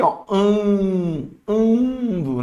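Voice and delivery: "ando" with a long held "an"; drawn-out nasal vowel. Shown as a demonstration. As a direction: stretch the nasal sound in "ando". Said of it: "Eu tô vendo aqui".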